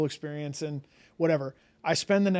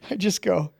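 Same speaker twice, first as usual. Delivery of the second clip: ordinary talk, nothing marked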